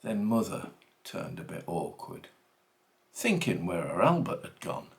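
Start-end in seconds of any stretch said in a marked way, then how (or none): none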